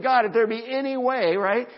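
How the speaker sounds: high, loud acted prayer; reluctant plea. Jesus in the garden asking God for escape, pleading. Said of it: "he did not want to do it".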